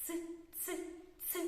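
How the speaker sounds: short, separate hard "Ц", like soothing someone; hushing hard ц. Acted. Teacher voicing a girl who soothes everyone, hard "Ц". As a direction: say "Ц" as a hard consonant, spaced out. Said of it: "живе дівчинка, яка всіх заспокоює".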